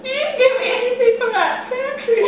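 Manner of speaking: high giggling words; laughing. Real, not acted. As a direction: talk through giggles. Both laughing.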